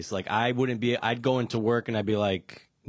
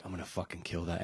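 muttered threat; 7 semitones lower, 11 dB quieter, faster than usual. Retold or imitated. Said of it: "I was like".

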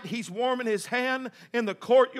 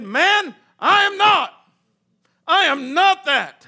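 raised, insistent protest; indignant denial; 4 semitones higher, 6 dB louder, slower than usual. Acted. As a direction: deny it loudly, slowing on the repeat. Peter's second denial acted out.

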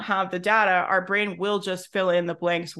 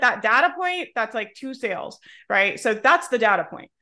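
ordinary talk

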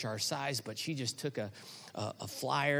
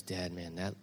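put-on soft, slow, hesitant child's worry; a hint of a scared little boy; 7 semitones lower, 8 dB quieter, slower than usual. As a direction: voice the small son's nerves quietly. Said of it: "And he goes".

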